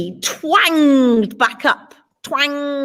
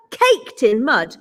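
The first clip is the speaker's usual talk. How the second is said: usual speaking voice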